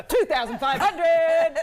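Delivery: loud, high, drawn-out bid call; auctioneer's big call. Shown as a demonstration. Auctioneer announcing Joey's bid to the room, loud drawn-out call. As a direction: belt out the winning bid, stretching each word. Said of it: "show you how to do it".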